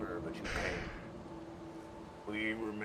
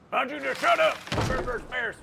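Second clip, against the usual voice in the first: higher, louder snapping taunt; angry villain; 6 semitones higher, 4 dB louder than usual. Acted. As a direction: bark it at the captive.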